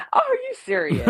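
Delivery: high squeal of mock outrage; shocked. Real, not acted. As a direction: squeal it, playfully offended. Story cut off with a no.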